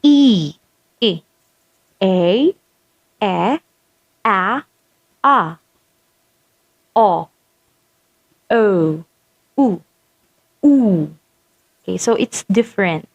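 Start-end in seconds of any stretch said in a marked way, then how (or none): 0.0-11.1 isolated vowels, one by one with pauses; vowel demo. Shown as a demonstration. Teacher shows how distinct the vowels are, spaced careful sounds. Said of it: "when you try to pronounce them".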